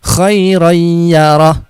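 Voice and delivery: slow recited "khairoy ya roh" with a throaty kh and a hummed oy; drawn-out "khairoy ya roh", kh from the throat; nasal merge into ya. Shown as a demonstration. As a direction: chant "khairoy ya roh", gliding the n into y. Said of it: "Ya, seperti itu".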